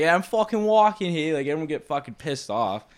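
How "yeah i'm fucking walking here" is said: raised, louder mock New Yorker; irate New Yorker. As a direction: imitate an indignant New York pedestrian. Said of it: "New York, people be like".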